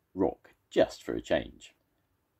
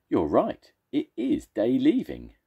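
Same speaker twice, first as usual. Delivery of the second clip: excited agreement; 8 semitones higher, 6 dB louder than usual. Acted. Monster realising.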